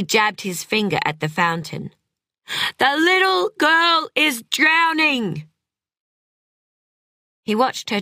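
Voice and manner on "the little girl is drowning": high, slow, stretched-out yell; desperate scream. A narrator's reading. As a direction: make every word carry through glass. Screaming warning through closed window.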